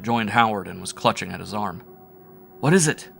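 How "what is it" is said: higher, louder frightened cry; cried out. A narrator's reading. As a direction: cry out in alarm. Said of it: "I cried".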